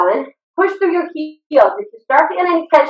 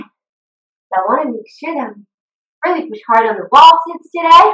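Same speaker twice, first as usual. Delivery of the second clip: normal talking voice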